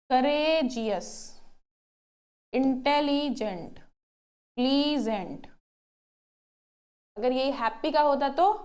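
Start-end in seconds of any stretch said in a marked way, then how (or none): 0.1-5.5 courageous, intelligent, pleasant drawn out slowly, syllable by syllable; slow syllable demo. Shown as a demonstration. Teacher to students, showing syllables, slow and drawn out. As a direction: stretch each word so students hear its syllables. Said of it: "क्या आप एक बार में बोल पाए?".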